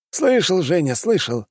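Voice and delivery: openly teasing; mocking. A narrator's reading. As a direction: poke fun at him. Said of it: "откровенно потешался над ним Пушкин".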